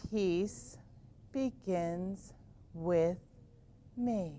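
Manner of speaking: slow mantra. Shown as a demonstration. Teacher guiding kids through the mantra slowly and softly. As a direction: breathe each word out slowly. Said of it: "Can you say it with me".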